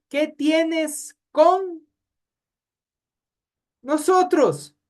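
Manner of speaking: high, very slow demon voice; possessed man's cry. Acted. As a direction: drag out the demon's question. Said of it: "que dice". (voz de endemoniado, aguda y muy lenta)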